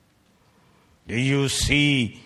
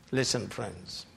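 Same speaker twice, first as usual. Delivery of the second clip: usual speaking voice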